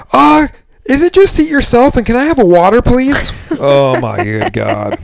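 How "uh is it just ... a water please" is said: high, loud whiny customer voice; mocking lady customer. Bartender mocking a lady in the corner, high whiny voice. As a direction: whine it like an impatient diner. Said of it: "there's a lady going".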